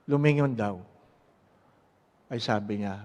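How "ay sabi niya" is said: ordinary talk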